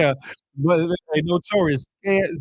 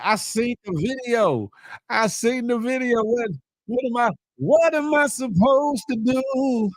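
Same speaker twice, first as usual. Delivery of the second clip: high-pitched, exasperated self-defence; hammed-up helpless plea; pleading protest; 9 semitones higher than usual. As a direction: protest high and drawn out, stretching the last words. Mock protest to a chat comment.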